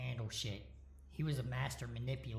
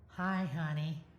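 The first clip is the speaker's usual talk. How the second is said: put-on higher, louder sweet girlfriend voice; cute upward inflection; 5 semitones higher, 6 dB louder, slower than usual. A narrator's reading. Girlfriend answering her boyfriend's call, cute and rising. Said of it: "cute upward inflection".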